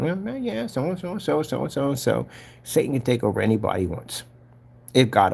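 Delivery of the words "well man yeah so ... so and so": high, soft mumbled chatter; light, high-pitched rambling; back-to-normal guy imitation. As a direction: imitate a man chatting away in a higher, quieter voice. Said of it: "he was back to normal, talking".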